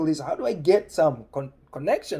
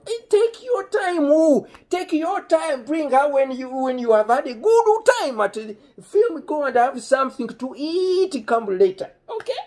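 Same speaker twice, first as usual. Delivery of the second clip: very high, loud mock generosity; imitating excited dad; 13 semitones higher, 9 dB louder than usual. Dad urging the kids to stay out late, hammed-up loud excitement. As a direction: sing out the send-off in a piercing father voice.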